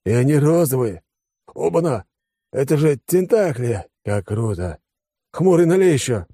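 high-pitched tipsy wonder; excited cries. A narrator's reading. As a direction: exclaim in delight at each new discovery. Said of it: "послышался крик Алекса издалека". (восторженные выкрики высоким голосом)

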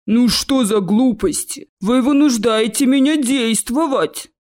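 slow, loud, lumbering troll. Acted. Troll warns students after the fireball, slow and booming. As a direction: boom a weighty warning.

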